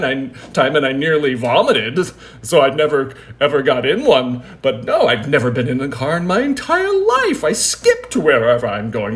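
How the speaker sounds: hammed-up indignant insistence; mock vehement denial. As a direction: double down on an absurd lie at full volume. Running joke parodies the lying waiter.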